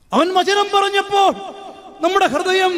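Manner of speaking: high, loud and slow proclamation; fervent preaching shout. Real, not acted. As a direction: proclaim it slowly at full voice. Preacher proclaims the disciples' words. (ഉച്ചത്തിൽ പതുക്കെ പ്രഘോഷിക്കുക)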